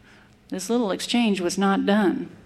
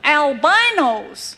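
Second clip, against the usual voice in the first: higher, louder, slow gasp of wonder; gasped surprise; 6 semitones higher, 4 dB louder, slower than usual. A narrator's reading. The old packer gasps at the city lady, amazed. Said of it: "he gasped".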